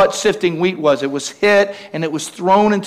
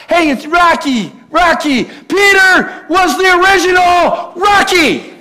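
excited shout; 10 semitones higher than usual. Real, not acted. Preacher hypes the congregation about Peter, shouting with a laugh. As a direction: cheer it out with a laugh, raising the pitch.

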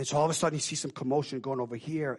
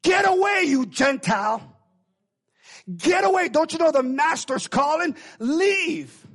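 high, loud shouting to drive her off; screaming disciples; 13 semitones higher, 9 dB louder than usual. Acted. Disciples shouting at the Gentile woman, loud angry yelling. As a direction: yell at her to get away. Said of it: "are screaming at her".

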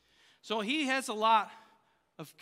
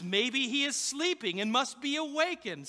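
higher-pitched mocking jibe; smart-aleck taunt; 6 semitones higher, 3 dB louder than usual. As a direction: needle them like a smart aleck. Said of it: "God's man is a total smart aleck".

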